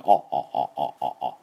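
short, repeated "あ" as if a pipe held the throat open. Shown as a demonstration. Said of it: "パイプが入っているような感じで".

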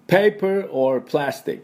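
"Paper or plastic" slowly, word by word; slow and separated. Shown as a demonstration. Teacher first giving the cashier's question, "Paper or plastic" slowly. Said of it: "これものすごく早く聞かれます".

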